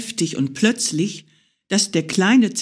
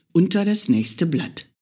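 ordinary delivery, nothing marked

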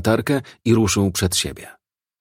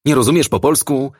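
higher-pitched irritated challenge; impatient snap; 6 semitones higher, 4 dB louder than usual. A narrator's reading. Kowalski to his doubting colleague, impatient snap. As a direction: snap back, fed up with being questioned. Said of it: "warknął zniecierpliwiony Kowalski".

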